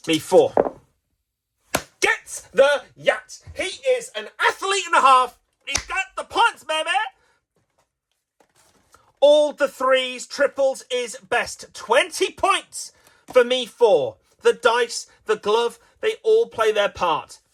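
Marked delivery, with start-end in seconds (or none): 2.0-6.9 ecstatic play-by-play. Pretend. Commentator celebrates a big roll. 9.2-17.4 high, slow triumphant announcing; excited play-by-play. Pretend. Excited commentary after the big roll.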